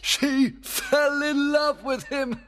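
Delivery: high, loud, drawn-out glee; gleeful outburst from the fairy king; delighted cry. Acted. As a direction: cry out with pleasure, stretching the words. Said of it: "cried Oberon with pleasure".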